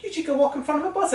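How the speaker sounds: high-pitched mock English girl's accent; imitated English girl's accent. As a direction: play a girl with an English accent. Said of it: "a girl with an English or Australian accent".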